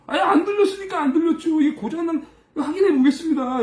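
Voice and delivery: high-pitched self-defence; acted flustered protest. Assistant answering back to scolding waiters, high flustered protest. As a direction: imitate a junior protesting innocence. Acted reply to angry coworkers.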